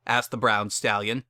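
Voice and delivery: plain reading voice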